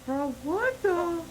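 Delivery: high, bewildered whine; alarmed disbelief. Real, not acted. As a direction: say it high, freaked out. Bewildered by the creepy number messages.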